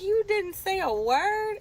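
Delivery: high, slow, incredulous cry of disbelief; aghast, drawn-out outrage; shocked disbelief. Retold or imitated. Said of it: "I was like".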